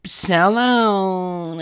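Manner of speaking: put-on high, loud whining little-kid voice; mock him as a crybaby tattling child; whiny Kendrick Perkins. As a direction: pitch it way up and whine like a tattletale. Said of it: "It's gonna be like that fucking".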